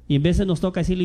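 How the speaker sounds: normal talking voice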